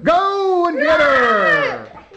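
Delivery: slow, drawn-out call urging them on; rallying shout. Acted. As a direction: send them off to fight the witch. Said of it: "go kill the Wicked Witch".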